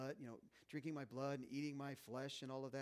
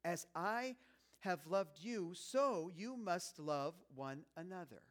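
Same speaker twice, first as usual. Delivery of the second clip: louder, slowed, every word weighed; emphatic quote; 3 semitones higher, 5 dB louder, much slower than usual. Acted. Jesus pressing his command on the disciples, slow and emphatic. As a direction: lean into each word of the command.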